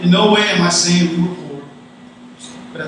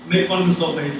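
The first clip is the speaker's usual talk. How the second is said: normal talking voice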